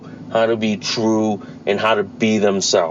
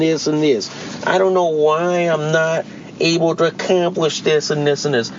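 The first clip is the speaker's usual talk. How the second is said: higher, whiny mock lament; mock whiny self-pity; 5 semitones higher than usual. Host mimicking young people who whine, higher mock lament. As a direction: ham up a self-pitying complainer. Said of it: "acting like shit, going like".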